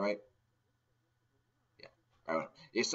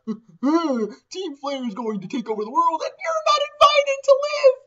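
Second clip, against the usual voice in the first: higher, slow, louder gloating rising to a shriek; hammy villain; 8 dB louder than usual. Retold or imitated. Lysander announcing world takeover, hammy villain gloat.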